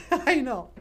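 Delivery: very high, loud cartoon puppet greeting; high puppet bonjour. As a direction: squeak it out like a bubbly puppet.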